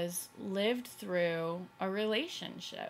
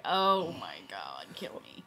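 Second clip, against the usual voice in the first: low, slow, drawn-out groan of a fed-up mother; a mother at her kids' cartoon, mock despair; exasperated mom; 8 semitones lower, 3 dB louder, slower than usual. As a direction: sag into it like a worn-out mom. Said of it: "she was just like".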